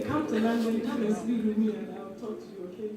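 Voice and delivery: ordinary delivery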